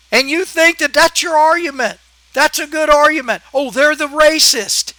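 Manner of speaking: fired-up angry rant. Real, not acted. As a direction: vent at the liberal commenter. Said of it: "I'm sorry to rant".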